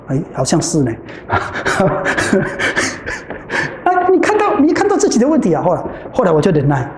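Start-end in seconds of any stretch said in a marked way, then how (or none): 1.0-3.8 soft laugh. Real, not acted. Laughs at own story.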